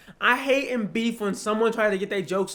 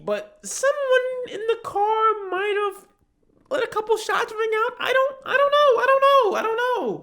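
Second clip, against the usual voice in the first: higher, louder whiny mock plea; mocking James; 10 semitones higher, 7 dB louder than usual. Mocking James as a defendant to a judge, high whiny pleading. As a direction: play a guilty defendant begging the judge. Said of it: "just James saying".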